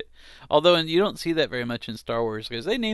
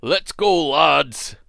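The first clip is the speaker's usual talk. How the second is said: put-on hearty Scottish accent, louder; 5 dB louder, much slower than usual. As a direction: call out like a Scottish pirate. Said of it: "I like to think of him having a weird Scottish accent".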